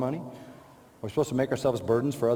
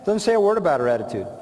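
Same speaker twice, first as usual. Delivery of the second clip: fast, pressing repeat; rapid insistence; 3 semitones higher, 3 dB louder, faster than usual. Real, not acted. Preacher repeating his rebuttal to the congregation, fast. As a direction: hammer it again quickly.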